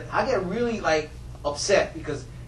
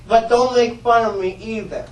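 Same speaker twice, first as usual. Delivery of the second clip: ordinary delivery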